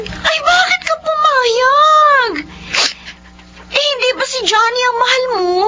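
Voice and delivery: high, shocked tearful cry. Acted. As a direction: cry out in disbelief. Friend shocked at engagement.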